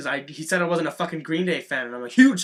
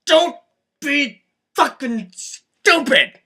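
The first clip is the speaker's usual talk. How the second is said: loud, slow, higher comic yell; mock shouted moral; 9 semitones higher, 7 dB louder, much slower than usual. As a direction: hammer home the moral.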